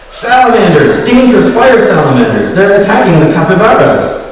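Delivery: loud, high panicked shout; frightened scream. A narrator's reading. Rowan truly needing help from the villagers, fearful scream. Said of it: "he screamed, his voice hoarse with genuine fear".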